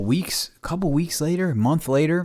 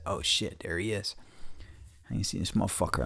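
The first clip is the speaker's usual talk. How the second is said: whispered aside; 2 semitones lower, 14 dB quieter, faster than usual. Retold or imitated. Seeing the old acquaintance at Home Depot, whispered dread. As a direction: mutter it under your breath so he won't hear.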